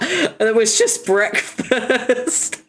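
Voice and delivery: slow, high, dissolving into laughter; laughing. Real, not acted. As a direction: let the laugh take over the words. Laughing at the breakfast gif.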